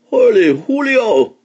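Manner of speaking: high, loud kitten squeal. A narrator's reading. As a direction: squeal it out in surprise. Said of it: "squealed the kitty boy".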